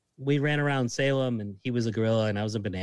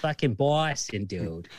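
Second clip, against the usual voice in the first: stretched Boston accent, drawn-out BAW-stin; Boston accent with a long AW; slower than usual. Shown as a demonstration. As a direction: say it slowly like a proud Boston local. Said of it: "the Boston accent".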